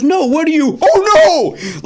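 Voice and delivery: high, loud shriek of oh no; wife shrieking in shock, voice shooting up; wife shrieks at the news of the wrong coat; loud shocked cry. Retold or imitated. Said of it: "Like, that loud, she said it".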